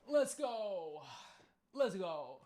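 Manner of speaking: loud repeated victory cheer; triumphant shout. Real, not acted. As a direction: yell it out in celebration. Celebrating a catch.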